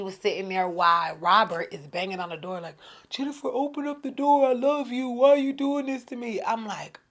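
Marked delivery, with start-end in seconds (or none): 3.1-6.4 high, loud retold plea; begging, mock crying. Drunk ex bangs on her door, mock tearful begging. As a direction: imitate a desperate man pleading at the door. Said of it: "the crying dude is in the outside begging".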